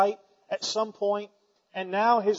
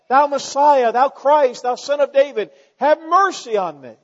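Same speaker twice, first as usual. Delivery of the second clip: pleading cry; 5 semitones higher, 6 dB louder than usual. Acted. Beggar cries to Messiah.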